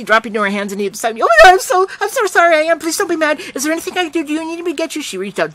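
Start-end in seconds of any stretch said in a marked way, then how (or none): 1.2-5.0 high, loud, rushed panic fading; a scared child begging forgiveness; frantic apology. A narrator's reading. Girl apologizing to collapsed narrator, high and frantic.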